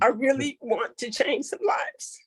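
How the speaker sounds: real emotional whisper; tearful whisper. Real, not acted. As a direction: barely get it out, overcome. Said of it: "that's why I get so emotional".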